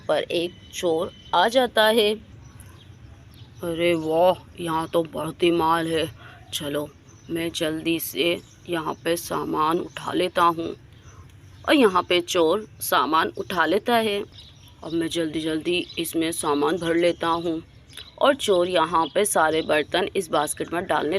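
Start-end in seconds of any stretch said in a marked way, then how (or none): 3.6-10.8 low, hushed and sly, pleased with the loot; sneaky thief whisper-talk. Acted. Thief to himself in the sleeping colony, hushed and sly. 14.8-17.6 low, hushed and sly, pleased with the loot; sneaky thief whisper-talk. Acted. Thief to himself in the sleeping colony, hushed and sly. Thief stuffing basket at night.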